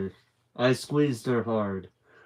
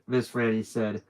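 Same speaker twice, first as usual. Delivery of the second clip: plain reading voice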